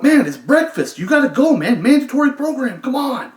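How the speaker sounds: high, loud insistent urging; urgent hurry-up. Retold or imitated. Prankster urging his cellmate to breakfast, loud. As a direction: rush him out the door.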